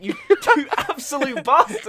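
laughing mock outrage at a friend; playful laughing accusation; laughing insult. Real, not acted. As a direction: curse him out through a laugh. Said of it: "And it was a good bit, too!".

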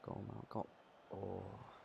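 usual speaking voice

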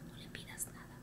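hushed aside, voice almost gone; whispered warning. Retold or imitated. Mother warns daughter not to ask Raúl, whispered. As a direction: breathe it so Raúl can't hear.

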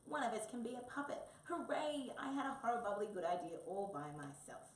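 loud, high, gleeful cheering; jubilant. Acted. Wombat celebrates his puppet idea, jubilant. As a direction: crow with joy over your own cleverness.

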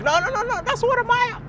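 imitating a defensive fan. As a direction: play the fan who always jumps to defend. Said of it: "this guy will be like".

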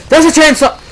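a sudden startled yelp of alarm; alarmed scream. Real, not acted. Sudden chainsaw attack alarm.

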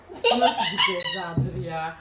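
laughing while tickled; being tickled. Real, not acted. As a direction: squeal and laugh through it. (gıdıklanırken gülerek)

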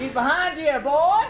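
mock Southern warning shout; southern accent. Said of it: "Stop talking in a southern accent".

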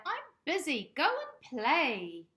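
high, loud shooing lady; busy lady. Acted. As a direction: brush the cat off briskly. Lady shooing the cat away.

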